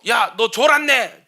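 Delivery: instructor's shout. Retold or imitated. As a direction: call out the slacker, drawn out. Instructor catching a dozing recruit. (교관의 느린 고함)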